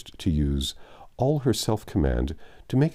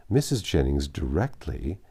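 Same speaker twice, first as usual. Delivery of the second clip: ordinary delivery, nothing marked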